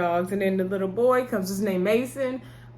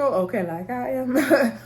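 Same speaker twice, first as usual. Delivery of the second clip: laughing; 4 semitones higher, slower than usual. Real, not acted. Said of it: "laughs at own joke".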